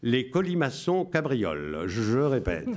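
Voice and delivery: solemn Radio Londres announcer. Shown as a demonstration. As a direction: read the coded messages like a BBC broadcaster. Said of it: "Je vous lis avec la voix".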